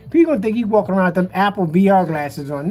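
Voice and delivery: ordinary delivery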